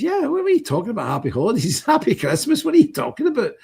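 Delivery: high, indignant disbelief at the greeting; retelling a friend's outraged reply to the school woman; incredulous retort. Retold or imitated. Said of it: "He says".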